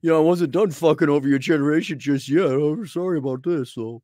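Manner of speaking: put-on a sarcastic take on an old boomer; mock boomer apology. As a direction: play a smug boomer saying sorry.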